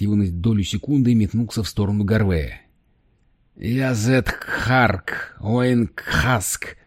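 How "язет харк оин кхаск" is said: very slow, creaking alien words; creaky alien voice. Acted. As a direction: grind out each alien word slowly. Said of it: "проскрипел он". (скрипучий голос пришельца)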